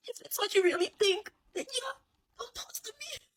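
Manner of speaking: very high, whispered, breaking with tears; tearful. Retold or imitated. Hurt ace telling his teammate not to toss to him, tearful whisper. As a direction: hold back the sobs, barely audible. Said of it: "tears will start welling up in his eyes".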